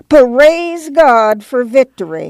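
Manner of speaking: put-on high, drawn-out old woman's bleat; reedy praise-the-Lord cry. A narrator's reading. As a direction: bleat it slowly like a pious grandma.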